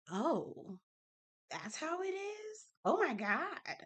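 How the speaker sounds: awed realization. Retold or imitated. Retold childhood shock at friends' homes.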